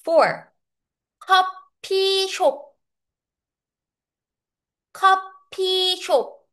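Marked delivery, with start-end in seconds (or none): 1.2-6.5 커피숍 high and split, keo, long pii, syop; Korean pronunciation. Shown as a demonstration. Teacher models Korean for coffee shop, careful Korean pronunciation. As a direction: say 커피숍 syllable by syllable, held high. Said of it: "we say shop".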